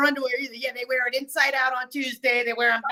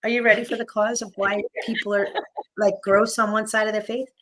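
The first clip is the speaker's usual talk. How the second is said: normal talking voice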